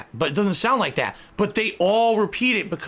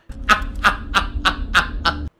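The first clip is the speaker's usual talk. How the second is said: airy laughing burst; second demo laugh; 3 semitones higher than usual. Shown as a demonstration. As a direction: another laugh to show.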